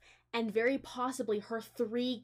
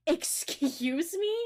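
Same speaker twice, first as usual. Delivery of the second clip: indignant; 7 semitones higher, 5 dB louder, much slower than usual. Real, not acted. Outraged reaction to the story.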